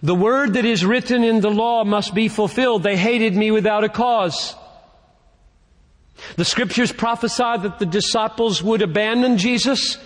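high-pitched, declamatory Bible reading; Scripture proclamation. Acted. Preacher reads prophecy to the congregation, proclaiming in a high pitch.

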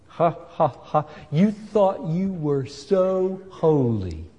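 slow, drawn-out gloating with a mock laugh; devil's mocking taunt. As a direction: gloat like the devil over a fallen sinner. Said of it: "That's the way the devil talks".

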